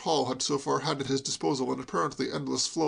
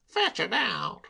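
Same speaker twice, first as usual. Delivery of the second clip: Soapy's voice; 6 semitones higher, much slower than usual. A narrator's reading. Soapy decides in desperation.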